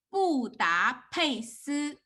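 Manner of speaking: 布达佩斯 in four slow, separate beats; slow teacher's quiz prompt; slow syllables. Shown as a demonstration. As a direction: space out each syllable of 布达佩斯. Teacher poses word in guessing game.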